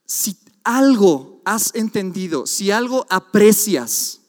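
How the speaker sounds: lento, fuerte, enfático; preaching. Acted. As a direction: predica cada palabra despacio. Predica con fuerza. (predicación enfática y lenta)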